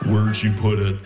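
low, ominous sleep-talker's voice; Buffalo Bill. Retold or imitated. Wife demanding an answer in her sleep, low creepy voice. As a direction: say it deep and creepy. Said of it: "Why do you sound like Buffalo Bill?".

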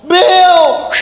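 high, loud drawn-out call; wife's long-distance shout; calling from a balcony. Retold or imitated. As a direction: holler the name down from a balcony. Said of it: "my wife called to me from the balcony".

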